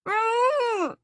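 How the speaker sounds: high, loud muffled scream; all but scream the name through a gag; near scream. A narrator's reading. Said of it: "I all but scream".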